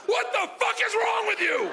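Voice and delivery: high-pitched mock outrage; appalled wife outburst. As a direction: imitate a horrified wife, pitch way up. Wife's appalled reaction, acted out.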